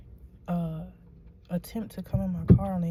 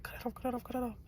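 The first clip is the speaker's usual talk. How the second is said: higher, softer mock urgency; mechanic's urgent order, retold softly in a higher pitch; 6 semitones higher, 8 dB quieter, much faster than usual. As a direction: quietly imitate a hurried, repeated order.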